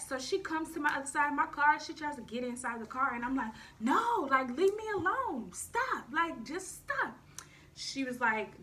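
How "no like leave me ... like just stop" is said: exasperated pleading. Retold or imitated. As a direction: push her away with your voice. Ex tries to get in car.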